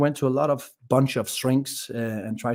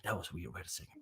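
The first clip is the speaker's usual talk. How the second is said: much softer, puzzled murmur to himself; quiet inner thought; 6 semitones lower, 19 dB quieter, faster than usual. Retold or imitated. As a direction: drop the voice, thinking aloud. Confused inner thought on flight.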